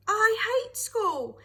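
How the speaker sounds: put-on high, loud and slow, a furious child's shout. A narrator's reading. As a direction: yell it out, hating every word. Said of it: "he shouted".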